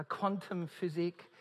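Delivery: ordinary talk, nothing marked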